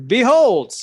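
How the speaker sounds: loud, high, grand reveal; big theatrical announcement; showy proclamation. Acted. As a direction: call it out like a stage magician. Character announces a magical display.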